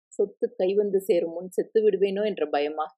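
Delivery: ordinary delivery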